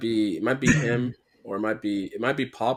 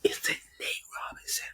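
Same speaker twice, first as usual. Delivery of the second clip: whispered question to the team; whispering; 8 dB quieter than usual. Real, not acted. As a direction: whisper it so the host can't hear. Secretly checks answer with team.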